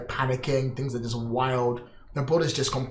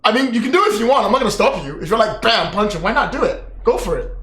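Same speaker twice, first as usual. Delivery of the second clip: excited; 8 semitones higher, 7 dB louder, faster than usual. Real, not acted. Urging a player, excited.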